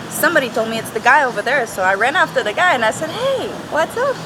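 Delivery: ordinary talk, nothing marked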